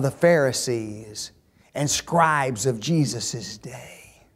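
slow, unvoiced whisper of scribes of Jesus' day; hushed whisper. Real, not acted. Preacher to his flock, slow hushed whisper. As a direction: say it as if naming a secret.

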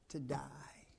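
soft unvoiced whisper. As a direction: drop it to a whisper. Sermon's hushed punchline.

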